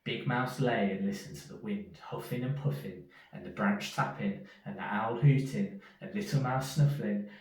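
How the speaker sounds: usual speaking voice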